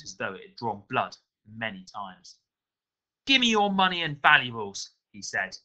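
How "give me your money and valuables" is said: loud, high mugger's demand; threatening demand. A narrator's reading. Mugger blocking the alley with a dagger, threatening demand. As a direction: demand it forcefully, pitched high.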